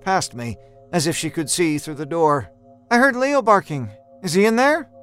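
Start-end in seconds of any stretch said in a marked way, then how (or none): 2.9-4.9 higher-pitched voice for Laura; questioning woman character. Acted. Laura asks about the dog behind the door, high questioning voice. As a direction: ask in a lifted, lighter-pitched character voice.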